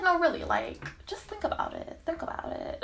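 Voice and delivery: soft imitation, fading to a whisper; hushed coaxing. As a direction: coax her, dropping away into a whisper.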